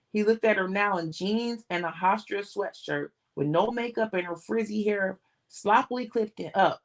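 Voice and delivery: ordinary delivery, nothing marked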